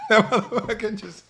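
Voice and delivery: cracking up mid-sentence; words squeezed out through real laughter; laughing too hard to finish. Real, not acted. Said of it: "I was laughing".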